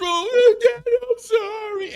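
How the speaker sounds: put-on high, slow girlish whine; whiny little girl. Daughter apologising to her father to avoid being yelled at, high and whiny. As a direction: whine sorry like a daughter dodging trouble. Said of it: "I said".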